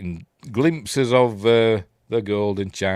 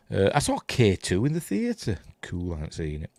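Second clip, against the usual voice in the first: usual speaking voice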